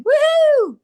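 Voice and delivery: high, loud whoop; a big cartoonish morning cheer; hammed-up excited woo; mock cheer. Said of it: "I don't wake up every day going".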